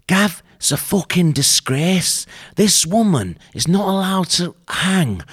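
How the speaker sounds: put-on high-pitched, outraged northern English accent; bad northern accent. Ranting to Gav about a headline, bad northern accent. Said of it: "that's not good accent".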